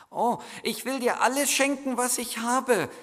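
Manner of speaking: put-on high, eager child's voice; boy offering everything, high and pleading; stammering boy. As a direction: blurt it out as the excited boy. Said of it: "stammelte der Junge".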